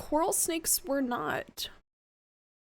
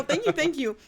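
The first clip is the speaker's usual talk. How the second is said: ordinary delivery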